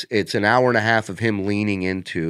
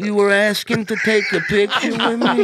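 Dice impression; 12 semitones higher, 4 dB louder than usual. Imitating Dice with fans.

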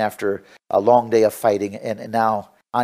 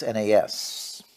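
ordinary talk

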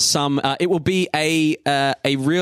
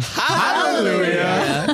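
gospel hallelujah; 4 semitones higher, much slower than usual. Pretend. Congregation cheers right fact.

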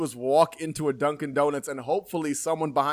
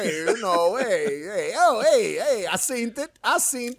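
higher-pitched mock New York tough guy; imitated thick New York accent; 4 semitones higher than usual. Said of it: "He's got that".

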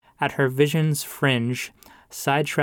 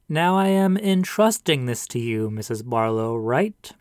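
pious pastor. Acted. Pastor entrusts the box to the widow, preachy.

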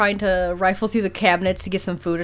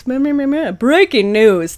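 slow, loud broadcast announcement; news anchor voice; 4 semitones higher, 5 dB louder, much slower than usual. Shown as a demonstration. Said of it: "my news anchor voice".

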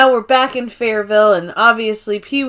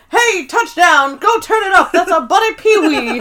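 high-pitched put-on holler; excited prisoner impression; 7 semitones higher than usual. Prisoners spot their buddy on TV, excited mock shouting. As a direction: call out to the gang in a hammed-up character voice.

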